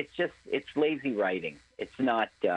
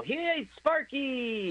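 put-on kid calling out; 12 semitones higher, much slower than usual. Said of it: "sometimes they come and".

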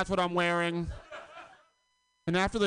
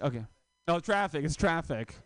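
ordinary delivery

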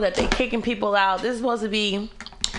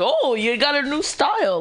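higher-pitched fussing over someone; mock gushing; 7 semitones higher than usual. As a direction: overdo the amazed compliment. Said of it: "She's not like".

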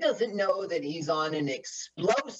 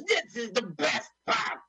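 higher, put-on voice of the henchman; mock frustrated henchman; 8 semitones higher than usual. Friend imitating a frustrated movie henchman, higher. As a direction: squeak it like a goofy villain. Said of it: "He goes".

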